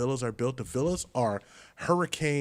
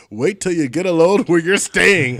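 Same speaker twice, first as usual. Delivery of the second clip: loud, booming, higher-pitched bus driver; old-school pitchman; 6 semitones higher, 13 dB louder than usual. Retold or imitated. Bus driver hyping passengers about their lodging, old-school pitchman. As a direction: boom it like an old soda-ad man. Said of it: "sounded like the old school years ago, like the seven-Up man".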